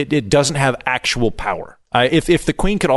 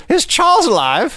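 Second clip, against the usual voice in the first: anxious courtiers asking. Retold or imitated. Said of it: "everybody goes".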